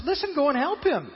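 Jesus urging the crowd, loud and pressing; urgent command. Acted. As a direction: tell them to hurry and help. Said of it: "Jesus says".

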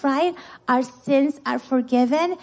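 ordinary talk, nothing marked

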